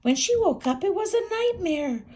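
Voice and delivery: high, loud dramatic build; dramatic storytelling. A narrator's reading. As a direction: raise it into alarm.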